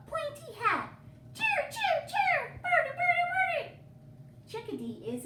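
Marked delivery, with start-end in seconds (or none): 1.3-3.8 very high, louder whistle calls; bright high birdsong; cardinal song. Acted. Reading cardinal call.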